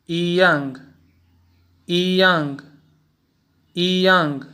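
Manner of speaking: ईयांग said as yí-yàng, ई rising then यांग falling; ईयांग: rise on ई, drop sharply on यांग; ईयांग in Mandarin tones, second tone then fourth; Chinese yiyang with tone change. Shown as a demonstration. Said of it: "ई का पहला tone दूसरे tone में तब्दील हो जाता".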